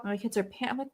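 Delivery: usual speaking voice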